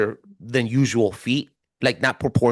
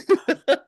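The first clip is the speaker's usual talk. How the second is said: short high laugh; high burst of laughter; 4 dB louder than usual. Real, not acted. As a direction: laugh out loud at yourself. Laughing at his own comparison.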